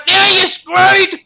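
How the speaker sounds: high, slow, triumphant shout; gleeful yell. Retold or imitated. Fisherman yelling as he dumps the girl in the drink, triumphant shout. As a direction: yell it out as he throws her off the pier. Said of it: "throws her in the drink and yells out".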